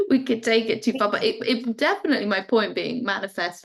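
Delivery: normal talking voice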